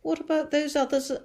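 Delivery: high, loud shouted question. A narrator's reading. Police chief shouting up to James. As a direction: shout it up anxiously. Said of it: "shouted the chief of police".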